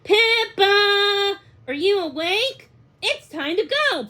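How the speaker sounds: high, loud call up the stairs; a boy yelling to wake his sister; shouting boy. A narrator's reading. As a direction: shout her awake, sing-song on her name. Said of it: "one boy shouted louder than the rest".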